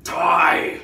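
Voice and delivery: sudden loud, high shout; mock yell. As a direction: burst out with a hammed-up war cry.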